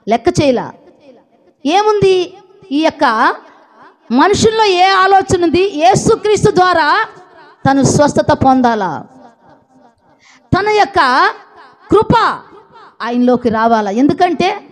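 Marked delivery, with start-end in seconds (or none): none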